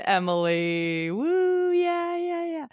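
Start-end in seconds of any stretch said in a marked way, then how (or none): 1.1-2.7 excited whoop up high; high cheering woo. Real, not acted. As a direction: cheer her in. Cheering the guest intro.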